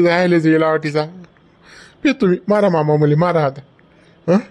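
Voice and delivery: usual speaking voice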